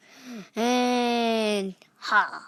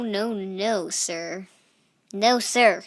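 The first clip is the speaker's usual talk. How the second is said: ordinary delivery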